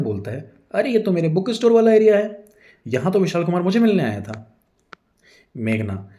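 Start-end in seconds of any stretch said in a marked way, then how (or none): 0.7-4.6 much higher, loud excited exclaim; excited recognition. A narrator's reading. Husband spotting his store in the photo, excited. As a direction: burst out recognizing the place.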